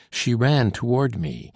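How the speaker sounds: plain reading voice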